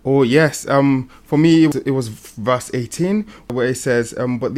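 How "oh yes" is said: ordinary talk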